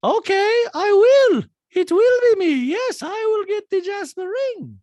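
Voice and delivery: very high, loud mock declaration; piercing falsetto impression; Diana. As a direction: announce it in a hammed-up squeal. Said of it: "And Diana's like".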